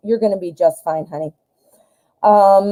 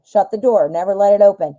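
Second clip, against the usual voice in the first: ordinary talk, nothing marked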